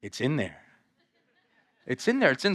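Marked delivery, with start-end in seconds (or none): 0.0-0.6 low, subdued admission; much lower and softer, under the breath; grudging scribes. Acted. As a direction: mumble it low and reluctantly, as the scribes. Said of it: "I could just see the scribes ... They're like".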